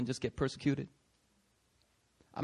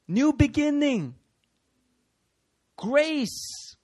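high, slow pleading sermon; impassioned preaching; 11 semitones higher, 4 dB louder than usual. Acted. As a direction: plead with them as if to a roommate.

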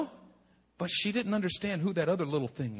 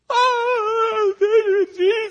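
sobbing, high-pitched cry of joy and relief; crying out in thanks; 12 dB louder, much slower than usual. Retold or imitated. New father at the baby's heartbeat, crying out in joy. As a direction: break into a tearful shout of thanks. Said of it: "I cried for days".

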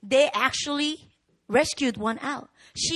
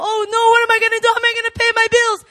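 fast, high, loud worried wail; hammed-up panic over bills; mock panic; 8 semitones higher, 8 dB louder, much faster than usual. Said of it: "That's fear shield".

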